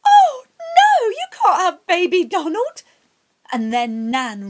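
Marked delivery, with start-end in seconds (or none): 0.1-2.8 much higher and louder, crying out in panic; a mother horrified at losing her baby; terrified Nan. Acted. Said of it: "Nan went pale with fright".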